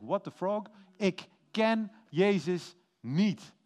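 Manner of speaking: slow, emphatic denial, word by word; mock cursing. Peter denies knowing Jesus when accused by the fire, mock cursing. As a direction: deny it with a mild mock curse, drawn out. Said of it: "Dan begint Petrus te vloeken".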